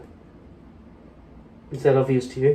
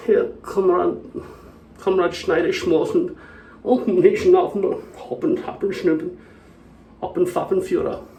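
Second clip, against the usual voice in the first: higher-pitched gibberish German; mock German; 5 semitones higher than usual. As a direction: rattle off nonsense words in fake German. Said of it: "Am I gonna be German?".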